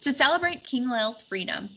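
plain reading voice

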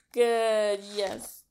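plain reading voice

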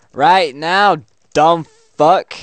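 high, loud insult at the bot; exasperated shout. Pretend. Player fed up with chatbot's questions, exasperated shout. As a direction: punch each word out, loud and high. Arguing with chatbot about when.

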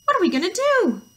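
high, loud mock wail; shouted storybook alarm; panicked cry. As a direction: escalate into hammed-up panic. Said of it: "they cried".

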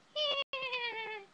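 put-on very high zombie-baby squeal; long, high creature noise; imitated baby squeal. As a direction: squeal like a mischievous little monster. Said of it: "He's like".

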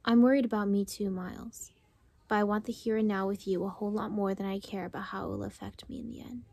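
soft, fast reading from the book, almost whispered; quiet reading aloud. A narrator's reading. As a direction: read the passage under your breath, quickly.

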